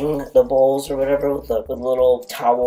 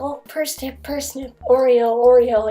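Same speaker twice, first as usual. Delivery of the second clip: cooing at cats; 11 semitones higher than usual. Real, not acted. Volunteer coos at the shelter cats, high baby voice. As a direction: coo their names like pet talk.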